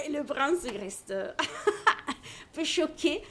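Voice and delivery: high, slow words broken by real laughter; laughing while telling. Real, not acted. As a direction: laugh through the line as you tell it.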